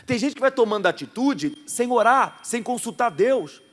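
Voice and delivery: slow, loud preaching emphasis; emphatic preaching. Acted. Pastor exhorting the congregation to think first, preaching. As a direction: drive the lesson home.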